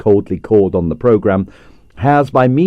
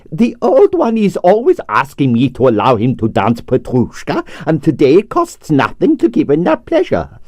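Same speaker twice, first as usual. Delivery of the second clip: put-on Russian accent; 5 semitones higher than usual. Stroganoff's accented voice.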